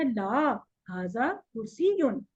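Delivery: drawn-out "ला", pause, slow falling "हाजा कुर्सियुन"; pause after ला. Shown as a demonstration. Teacher shows the comma in the answer, pause after ला. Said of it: "थोड़ा सा pause लेना है".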